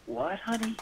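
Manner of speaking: high, soft, sweetly innocent question; innocent wife. Retold or imitated. Wife answering husband's call from the hunt, innocent. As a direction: imitate an unsuspecting wife, pitched well up. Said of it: "my wife so innocently".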